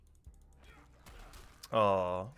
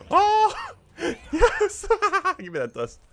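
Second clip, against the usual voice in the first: high, loud, laughing victory shriek; ecstatic cheering; 9 semitones higher, 9 dB louder, slower than usual. Real, not acted. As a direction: celebrate the win wildly. Victory over strong goblin.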